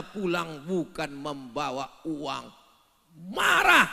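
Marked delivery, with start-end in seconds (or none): 3.3-3.9 mock angry shout.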